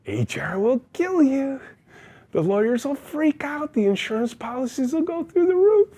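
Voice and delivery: dark inner voice. Retold or imitated. Inner dark voice scaring the speaker off saying yes, hushed warning. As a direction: hiss the warnings like a nagging inner doubt. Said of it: "The dark voice says".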